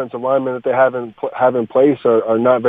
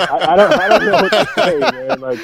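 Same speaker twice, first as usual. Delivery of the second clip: laughing; 8 semitones higher than usual. Real, not acted. Laughing in disbelief.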